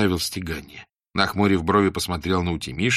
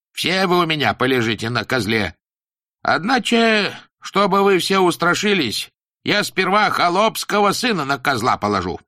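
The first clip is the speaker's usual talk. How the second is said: stern teacher voice; 9 semitones higher, 4 dB louder than usual. Acted. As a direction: preach it like a strict old teacher. Teacher threatens pupils.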